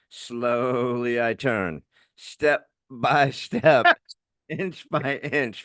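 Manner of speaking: drawn out very slowly, word by word; slow, menacing quote. Retold or imitated. Man quoting an old I Love Lucy bit, slowly. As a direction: stretch each step with long pauses. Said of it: "that I Love Lucy episode".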